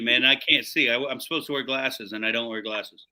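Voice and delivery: ordinary talk, nothing marked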